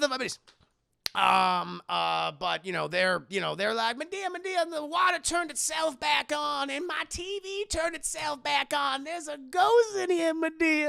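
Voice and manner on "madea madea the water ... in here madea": high, whining panic; frightened pleading. Acted. Aunts telling Madea there's a ghost, high whiny fright. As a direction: wail it like a scared auntie.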